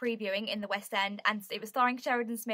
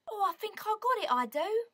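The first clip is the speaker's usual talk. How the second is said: higher-pitched, hammed-up Cockney; playful mock-Cockney chirp; attempted Cockney; 9 semitones higher than usual. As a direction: put on a bad Cockney accent. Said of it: "But I can't do Cockney".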